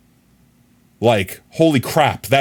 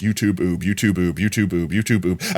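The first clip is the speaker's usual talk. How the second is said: tongue-twister repetition; 6 semitones lower, 5 dB quieter than usual. Shown as a demonstration. Streamer trying it five times fast, quick low tongue twister. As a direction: rattle "YouTube, Oob" off in a low, soft run. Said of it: "Say that, like, five times fast".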